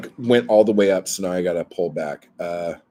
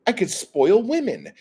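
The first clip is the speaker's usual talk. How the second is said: mock gleeful guy; 10 semitones higher than usual. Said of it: "he's like".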